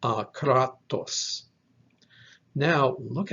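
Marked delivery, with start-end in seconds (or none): 0.0-1.4 akratos said slowly, stressing the middle KRA; a-KRA-tos, drawn out with a strong middle in akratos; stress on second syllable. Shown as a demonstration. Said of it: "with a stress on that second syllable".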